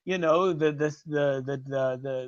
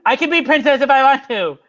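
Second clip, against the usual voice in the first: defiant; 6 semitones higher, 7 dB louder than usual. Acted. Acted argument over being princess.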